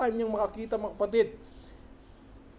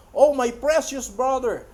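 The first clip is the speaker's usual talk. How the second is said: imitated screaming; 4 semitones higher, 5 dB louder than usual. People greeting a brother in a vision, mock screaming. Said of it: "Running, screaming, sumisigaw".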